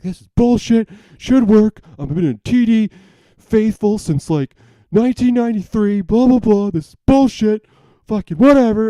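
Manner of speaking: high, loud indignant outburst; frustrated rant. Retold or imitated. Man re-enacting his bank transfer meltdown, high frustrated rant. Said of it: "I was getting real frustrated".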